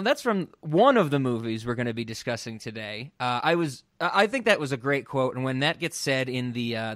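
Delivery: usual speaking voice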